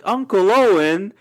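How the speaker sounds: loud, high, drawn-out call; imitated Luke calling. Friend mimics Luke running around calling his uncle, loud drawn-out call. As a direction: stretch it out high as if calling for him. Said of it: "the way he said it in this one scene".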